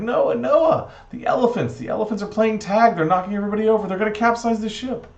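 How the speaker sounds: high, loud frantic report; panicked son. Acted. Son warns Noah elephants may capsize ship, panicked. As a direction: raise the alarm, high and loud.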